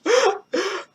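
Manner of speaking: short high laugh; real high-pitched giggle. Real, not acted. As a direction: laugh at your own lame bit. Laughs at own flirting bit.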